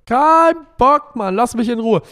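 loud, high-pitched exasperation; fed-up outburst. Acted. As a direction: burst out in acted annoyance. Acted fed-up outburst over chores.

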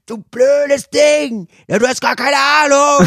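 mock yelling. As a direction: yell at the mediator in a mock rage. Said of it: "sie brüllen Mareike an". (gespielt wütendes Anbrüllen, viel höher)

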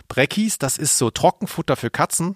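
ordinary talk, nothing marked

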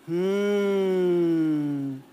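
long relishing Mm hum. Shown as a demonstration. Coach humming with delight as example. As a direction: savour the hum with pleasure. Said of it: "Schwelgen Sie in Ihrem Summen".

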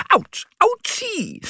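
hohes, langsames Jaulen; jaulendes Autsch. Acted. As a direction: jaul gedehnt vor Schmerz. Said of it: "jault er". (langgezogenes hohes Autsch)

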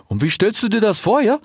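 high-pitched, curious animal voice; questioning Ilias. A narrator's reading. Ilias asks about the plan, high questioning voice. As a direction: ask it high and lifted. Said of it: "fragte Ilias".

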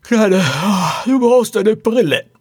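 high, loud yawning groan; groaning through a yawn. A narrator's reading. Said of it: "stöhnte die Frau". (hohes, gähnendes Stöhnen)

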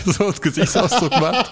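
spricht hoch durch ein Lachen hindurch; lachend. Real, not acted. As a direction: die Worte lachend herausprusten. Lacht beim Erzählen der Szene. (lachend erzählt)

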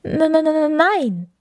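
high-pitched stammering fox; startled stutter. A narrator's reading. Startled fox denies it to the bear, stuttering high. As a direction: stutter on the first sound before getting the word out. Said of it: "erschrak und stotterte".

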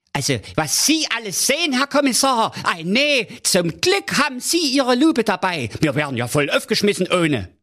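very high, gushing comic king; king deeply impressed by the commissioner; awed King Pilsner. Acted. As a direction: marvel at the cop like a star-struck king.

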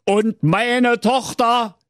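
high, drawn-out royal voice; high-pitched Berlin king; self-important king. A narrator's reading. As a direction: start a grand complaint in a high voice. King starts his report.

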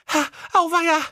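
squeaky high cry of dismay; high cartoon sister's alarm; eldest sister crying out. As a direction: yelp it high like a panicked girl. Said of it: "rief die Älteste".

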